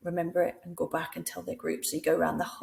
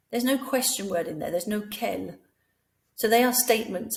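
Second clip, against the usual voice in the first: normal talking voice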